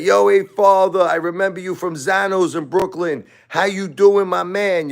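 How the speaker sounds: high, hammed-up Brooklyn Italian guy; Brooklyn Italian impression. A fake-friendly hater greeting him, in a high hammed-up Brooklyn Italian accent. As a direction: ham up a phony Brooklyn paisan. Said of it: "So they'll be like".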